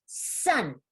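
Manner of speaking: sounds squeezed into one quick word; super fast. Shown as a demonstration. Teacher showing students how to blend, super fast. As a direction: blend it into a single short, crisp word. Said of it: "Super fast".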